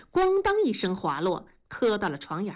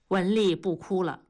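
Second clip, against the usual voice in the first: ordinary delivery, nothing marked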